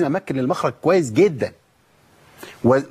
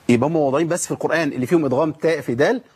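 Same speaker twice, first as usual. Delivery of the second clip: usual speaking voice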